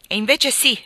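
high, loud, insistent shout; shouted after him. A narrator's reading. Hattersley following his friend out, shouting after him. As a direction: call out forcefully to someone leaving. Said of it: "gli gridò dietro Hattersley".

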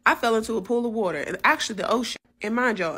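usual speaking voice

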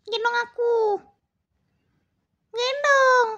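high, loud cat voice; demanding cat. Pretend. Cat demands Cici carry it, high insistent cat voice.

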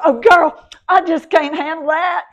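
overwhelmed drama queen, hammed up high and loud; drama queen impression. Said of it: "because they're going".

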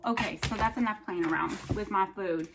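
usual speaking voice

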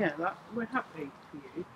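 low, soft deadpan sarcasm; underwhelmed, sarcastic congratulations. Real, not acted. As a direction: say it quietly, without any enthusiasm. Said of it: "That was so sarcastic".

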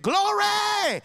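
high, loud, drawn-out church shout; hammed-up holy shout. Sister testifying to the church, a hammed-up joyful shout. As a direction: let glory ring out in mock rapture. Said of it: "amen, I shouted".

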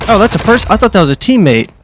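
startled. Real, not acted. Startled by an enemy player.

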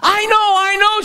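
put-on high-pitched, excited child; eager little girl. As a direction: pipe up like a keen little girl. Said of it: "a little girl waved her hand".

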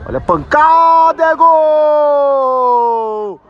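loud, high burst on the goal; explosive goal commentary. Real, not acted. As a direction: blow up the moment the ball hits the net. Shot goes in, commentator erupts. (narração explosiva de gol)

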